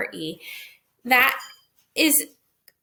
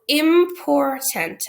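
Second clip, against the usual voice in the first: IMPORTANT drawn out slowly in three syllables; slow, emphatic im-por-tant; 5 semitones higher, 5 dB louder, much slower than usual. Shown as a demonstration. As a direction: stretch important syllable by syllable, louder and higher. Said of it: "I consider three syllables".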